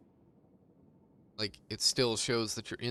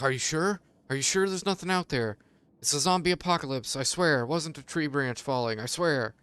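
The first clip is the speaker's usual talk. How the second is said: higher, pleading dog asking again and again; mock worried dog, higher and quick in spots; anxious dog voice; 3 semitones higher than usual. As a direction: voice the nervous dog begging you to check. Dogs voiced doubting owner.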